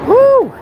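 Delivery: loud high whoop; cheering whoop. Real, not acted. Joking about plane spotting in the fog, loud whoop. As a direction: let out a big cheer.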